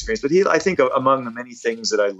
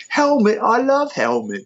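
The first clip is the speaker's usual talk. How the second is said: much higher, slow star voice in a different timbre. Retold or imitated. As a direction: squeal the band's name like a delighted rock star. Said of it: "have him say".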